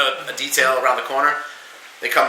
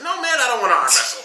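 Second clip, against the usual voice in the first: laughing refusal; 9 semitones higher, faster than usual. Retold or imitated. Refusing arm wrestle, laughing.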